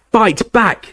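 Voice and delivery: high screaming demand; Harry screaming at Snape to fight; screamed. Acted. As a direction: scream it at him in fury. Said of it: "Harry screamed at him".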